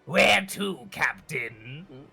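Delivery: creature advisor. Acted. Large beaked creature advising the captain on the route. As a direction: counsel the captain with care.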